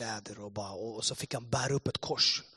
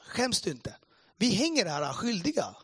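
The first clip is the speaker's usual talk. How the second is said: higher-pitched retold scolding; indignant rebuke; 6 semitones higher, 3 dB louder than usual. Second thief scolding the mocker, indignant. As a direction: rebuke him with feeling.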